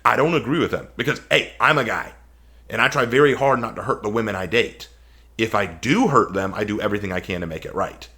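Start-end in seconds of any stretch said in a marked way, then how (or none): none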